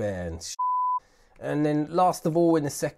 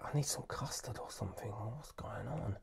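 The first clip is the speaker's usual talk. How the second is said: much softer, hushed muttering to himself; whispered aside; 2 semitones lower, 14 dB quieter than usual. Real, not acted. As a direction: mutter it under your breath.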